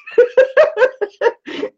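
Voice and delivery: loud high cackle of laughter; high burst of laughter. Real, not acted. Laughs at the joke.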